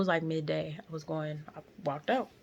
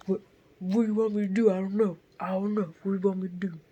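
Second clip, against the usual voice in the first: loud, raised-pitch helpless protest; mocking imitation; 3 semitones higher, 7 dB louder, faster than usual. Driver brushing off the woman he hit, loud mocking imitation. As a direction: throw up an exasperated defence, loudly. Mimics driver again, asshole.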